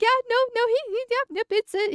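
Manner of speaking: high, giggly, squeaky mock reassurance; mock Arch. Streamer imitating Arch covering for her, squeaky high. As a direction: chirp it out way up high like a friend covering a joke. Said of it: "And Arch is like".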